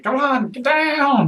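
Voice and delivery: high, loud, drawn-out yell; shouted warning. Acted. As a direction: stretch out an urgent shout to take cover. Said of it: "Are you shouting this".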